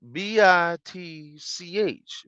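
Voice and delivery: drawn-out, pointed spelling; spelled-out insult. Retold or imitated. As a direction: spell it out deliberately for someone.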